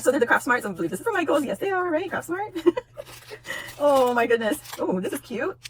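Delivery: ordinary talk, nothing marked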